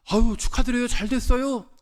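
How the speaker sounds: high-pitched, happy well-wishing; retold burst of gladness; delighted congratulations. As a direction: congratulate with a lifted, excited voice. Retold congratulations on new job.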